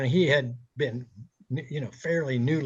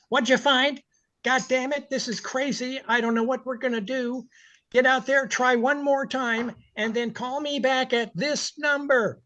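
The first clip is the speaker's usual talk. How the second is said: high, frantic ranting boss voice; imitating a frazzled angry owner; mock exasperated boss; 9 semitones higher than usual. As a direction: rant like an owner losing his mind. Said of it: "[name] is impersonating Stan Kaplan".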